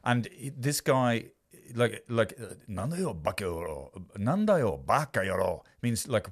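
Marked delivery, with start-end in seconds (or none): 2.7-5.6 low, muttered Japanese threat; Yakuza thug in Japanese. Retold or imitated. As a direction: mutter it like a gangster. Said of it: "in Japanese".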